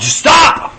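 high, sharp warning cry; sudden cry of stop. Acted. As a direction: call out as if stopping someone in their tracks. Voicing a sudden inner warning.

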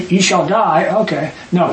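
normal talking voice

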